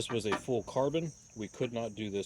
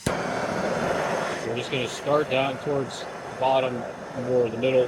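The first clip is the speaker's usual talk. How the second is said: raised voice over noise; 2 semitones higher, 5 dB louder than usual. Real, not acted. Talking over running torch.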